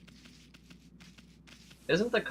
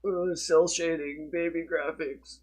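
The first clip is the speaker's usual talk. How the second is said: slow, high sneering voice; mocking quote; 6 semitones higher, 5 dB louder, slower than usual. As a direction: sneer it like a snob gamer. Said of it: "were like".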